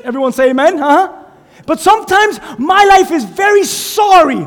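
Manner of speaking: loud, high, stretched preacher shouts; full-force preaching. Acted. Pastor shouting to the church about life's highs and lows. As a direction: preach it up to the rafters. Sermon climax shouting call and response.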